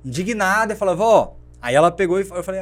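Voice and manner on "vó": exasperated call. Retold or imitated. Exasperated grandson calls grandmother.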